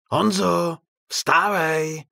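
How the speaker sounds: high, loud distant call; far-off wake-up call; calling from afar. A narrator's reading. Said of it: "tentokrát z dálky".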